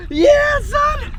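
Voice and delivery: high, loud joyous yell; ecstatic laugh and shout. Real, not acted. Diver cheering to his dog, whooping.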